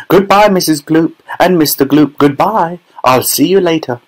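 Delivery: high, lilting goodbye; cheery farewell. A narrator's reading. Wonka waving the parents off, cheerily.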